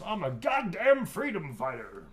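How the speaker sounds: loud, slow, high-pitched proclamation; defiant character quote. Acted. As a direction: declare it big and drawn-out in character. Performs a quote a fan requested.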